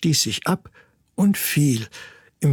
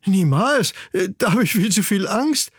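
higher, frightened chick voice; horrified refusal; 6 semitones higher than usual. Acted. Daughter chick refuses to plunge into the depths, horrified. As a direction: refuse in childlike dismay. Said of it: "rief ihr Töchterchen entsetzt".

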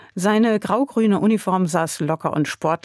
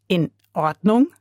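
disbelieving question, rising at the end; incredulous echo; 2 semitones higher than usual. A narrator's reading. Lotte silently doubting her grandfather's agreement, incredulous. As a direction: throw it back in disbelief. Woman echoes the answer in disbelief.

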